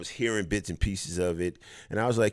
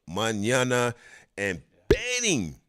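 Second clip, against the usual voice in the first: ordinary talk, nothing marked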